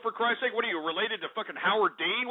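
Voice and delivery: usual speaking voice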